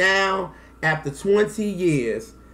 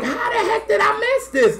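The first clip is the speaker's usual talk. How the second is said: surprised. Retold or imitated. Learning of new album release.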